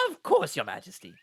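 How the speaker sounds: high, loud servant voice; servant to royalty. Acted.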